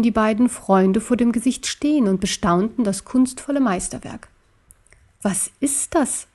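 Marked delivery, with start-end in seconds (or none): 5.2-6.3 softly voiced, higher and slow; half-whispered question, drawn out. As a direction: ask it in a hushed, wondering undertone. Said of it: "verlüsterte Wuff".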